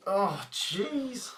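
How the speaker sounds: ordinary talk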